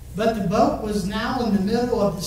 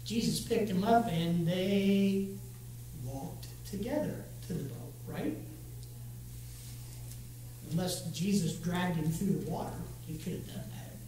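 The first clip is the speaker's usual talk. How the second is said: much softer, lower wry aside, fading to a whisper; quiet aside; 3 semitones lower, 10 dB quieter than usual. Real, not acted. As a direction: slip the joke in under your breath. Wry aside about Peter.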